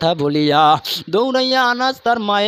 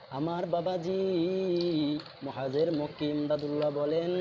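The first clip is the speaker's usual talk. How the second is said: usual speaking voice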